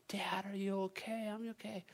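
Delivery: high, muted mock scream; Easton. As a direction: imitate a panicked child calling, without volume. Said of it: "I hear Easton just screaming".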